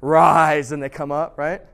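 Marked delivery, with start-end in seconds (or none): 0.0-0.7 louder, hammed-up command to rise; a preacher raising someone from the dead, mock commanding; mock miracle command. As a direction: boom it like a pretend faith healer. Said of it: "You're like".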